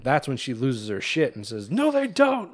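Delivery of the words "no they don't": sudden high shrieking no, higher; retold scream. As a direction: shriek the denial at him, imitating her. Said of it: "she starts sc-, screaming".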